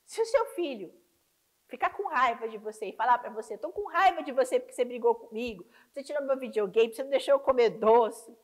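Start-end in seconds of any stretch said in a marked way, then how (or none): 3.6-8.4 put-on loud, sulky child's complaint, rising in force; mock angry child. A mother voicing her angry child, loud and sulky. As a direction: whine it out like a cross kid losing his videogame.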